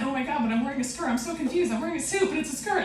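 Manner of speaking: ordinary delivery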